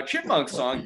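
ordinary talk

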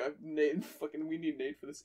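ordinary talk

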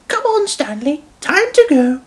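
high-pitched lady urging departure; Dowager Hat. A narrator's reading. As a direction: chivvy the engine along in a high old-lady voice. Dowager Hat turns to Stanley.

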